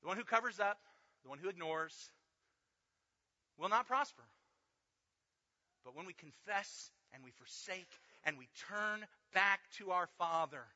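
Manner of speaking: loud, high, driving sermon; full-force preaching. Acted. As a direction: preach it out hard to the room.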